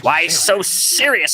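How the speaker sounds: slow, high, menacing Joker. Pretend. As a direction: drag it out like the Joker. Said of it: "famous Joker quote".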